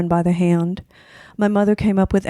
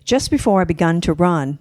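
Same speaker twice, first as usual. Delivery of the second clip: usual speaking voice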